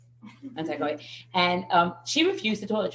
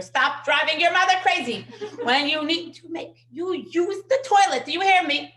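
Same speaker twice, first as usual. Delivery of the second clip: imitating a tough pediatrician's forceful telling-off; yelling doctor; 9 semitones higher, 7 dB louder than usual. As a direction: lay down the law to a child. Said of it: "I could have yelled also".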